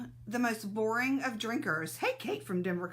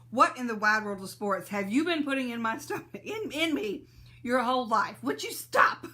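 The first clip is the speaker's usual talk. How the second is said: complaining stomach; 5 dB louder than usual. Pretend. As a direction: scold yourself as your own fed-up stomach. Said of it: "my stomach is gonna revolt and say".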